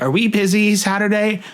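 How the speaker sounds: blurting it out nervously; voice shaking. Retold or imitated. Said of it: "voice shaking".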